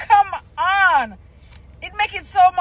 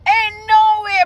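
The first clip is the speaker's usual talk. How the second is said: disbelief; 7 semitones higher, 5 dB louder than usual. Real, not acted. Disbelief at spelling.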